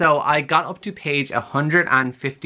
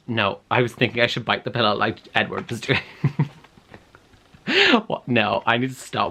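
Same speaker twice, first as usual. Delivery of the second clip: normal talking voice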